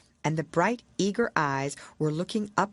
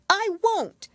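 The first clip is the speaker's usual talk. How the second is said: put-on very high, louder girlish refusal; defiant Alice; 6 dB louder than usual. Alice defying the Queen, high and defiant. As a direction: refuse like a stubborn little girl. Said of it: "said Alice".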